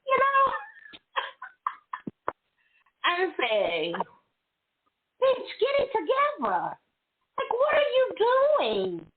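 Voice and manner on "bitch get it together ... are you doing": high-pitched self-directed telling-off; scolding herself. Retold or imitated. Retelling her roadside talk to herself, high-pitched scolding. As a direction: talk some sense into yourself, high and exasperated.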